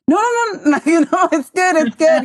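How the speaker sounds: very high-pitched, laughing protest; hurried mock reassurance. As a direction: squeal it like a nervous kid placating grandma. Acts out own reply to Nona.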